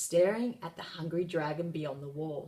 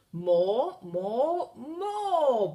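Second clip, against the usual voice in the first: loud bellow rising high; dragon bellowing; 6 semitones higher, 6 dB louder, much slower than usual. A narrator's reading. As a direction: bellow hungrily for more. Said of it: "bellowed the dragon".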